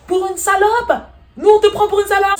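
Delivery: very high, loud indignant echo; acted offended yelling; outraged shrieking. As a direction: screech back in a mock quarrel. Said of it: "ça crie".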